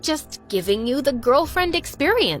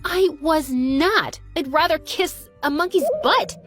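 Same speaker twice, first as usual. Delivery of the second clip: indignant denial; 6 semitones higher than usual. Acted. Girl denies liking the kiss, indignant. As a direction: deny it emphatically, pitch up.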